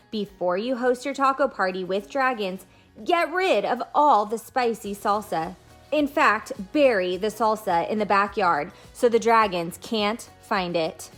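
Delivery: usual speaking voice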